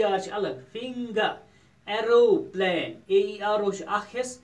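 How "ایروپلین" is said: "Aeroplane" slow and loud, as aero-plane; split into syllables. Shown as a demonstration. Teacher dividing a word for pupils, "aeroplane" split slowly as aero-plane. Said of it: "یَتھ چھِ زٕ syllable division".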